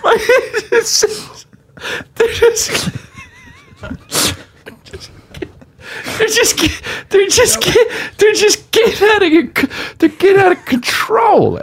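squeaky, very high, choked with laughter; real laughing fit through the words; helpless laughter. Real, not acted. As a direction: fight to get the words out while cracking up. Cracks up retelling the line.

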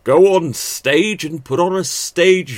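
high, loud, slow theatrical projection; stage voice. Shown as a demonstration. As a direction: boom it out like a ham actor. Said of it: "Nobody speaks like this".

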